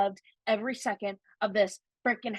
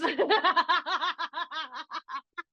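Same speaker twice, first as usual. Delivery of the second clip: bright caught-out laughter; high embarrassed laugh; 6 semitones higher than usual. Real, not acted. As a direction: giggle sheepishly at the slip. Laughs at own spoiler.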